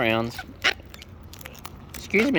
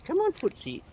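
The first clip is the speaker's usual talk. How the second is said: higher coaxing call to the bird; 11 semitones higher, 5 dB quieter than usual. Real, not acted. Coaxing Footsie the bird closer, high call. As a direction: lift it up high to coax the bird over.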